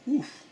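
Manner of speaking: high gasp at the burn; sharp burning gasp. Real, not acted. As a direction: gasp as the heat hits. Reacting to hot sauce burn.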